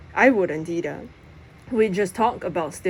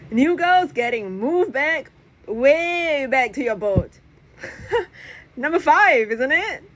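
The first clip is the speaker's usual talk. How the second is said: high, slow mocking taunt; teasing taunt; 7 semitones higher than usual. Press teasing his friend about his boat, high and mocking. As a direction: needle your friend playfully.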